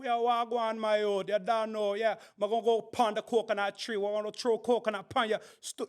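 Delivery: hammed-up Jamaican accent for social media. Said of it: "my favorite accent is a Jamaican accent".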